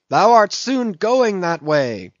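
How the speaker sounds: high, slow fanatic's cry; cried out. A narrator's reading. Gabriel crying to Ahab to keep the dead man's letter, high and slow. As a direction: hurl it at him like a curse. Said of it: "cried Gabriel to Ahab".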